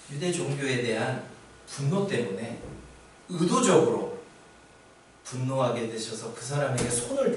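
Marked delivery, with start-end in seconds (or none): none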